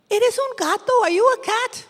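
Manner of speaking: high little mice asking the cat. Acted. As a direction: ask the big animal nervously.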